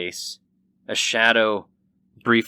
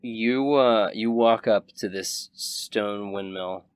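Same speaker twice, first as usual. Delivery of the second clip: ordinary delivery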